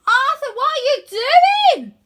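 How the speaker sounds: high, loud alarmed yell; loud confused cartoon yell; Wyn yelling. A narrator's reading. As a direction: shout down in alarm. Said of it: "yells Wyn".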